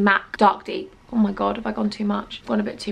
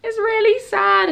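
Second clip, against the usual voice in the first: higher, slow, hammed-up whine; mock sadness; 9 semitones higher, slower than usual. Mourning a broken product, hammed-up whine. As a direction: pout over the broken palette.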